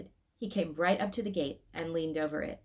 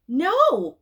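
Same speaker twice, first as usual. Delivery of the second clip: a sudden, loud, firm no; loud clear no; 10 semitones higher, 13 dB louder than usual. A narrator's reading. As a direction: blurt it out loud and clear so Mama jumps. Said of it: "so loudly and clearly that Mama jumped".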